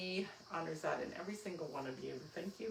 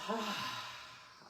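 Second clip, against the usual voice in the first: audible sigh; 2 semitones higher than usual. Shown as a demonstration. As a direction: let a big sigh fall out. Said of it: "A big sigh".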